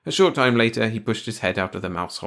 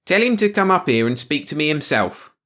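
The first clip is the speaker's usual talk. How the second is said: smug; 7 semitones higher, 6 dB louder than usual. Acted. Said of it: "feeling very clever".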